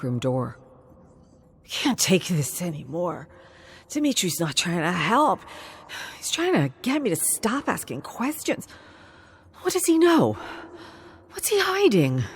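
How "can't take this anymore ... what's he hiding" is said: rising frustration. Acted. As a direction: build from grumbling to a frantic, high outburst. Gabriel frustrated at Dimitri.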